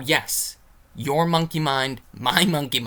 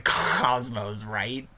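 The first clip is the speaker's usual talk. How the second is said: ordinary delivery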